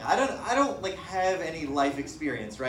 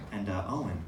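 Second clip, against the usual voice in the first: low mafia threat; 7 semitones lower, 4 dB quieter than usual. Retold or imitated.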